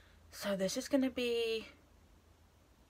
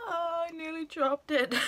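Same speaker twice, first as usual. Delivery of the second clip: startled; 9 semitones higher than usual. Real, not acted. Nearly drops palette.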